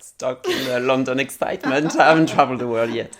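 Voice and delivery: fast and loud, laughing it out; quick, loud laughing confession. Real, not acted. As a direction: blurt it out with a laugh. Laughing admission.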